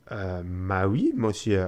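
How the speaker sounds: slow mock French accent. Passepartout answering his master in a French accent. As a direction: drawl it out in French. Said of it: "this accent".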